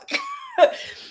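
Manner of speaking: short high-pitched laugh; brief amused burst. Real, not acted. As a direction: let out a quick squeal of laughter. Laughs at how wrong it is.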